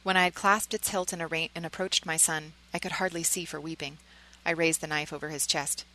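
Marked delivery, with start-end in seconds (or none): none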